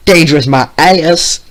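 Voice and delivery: loud, high furious retort; shouting back. Acted. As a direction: fire it straight back at him. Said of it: "Vio shouted back".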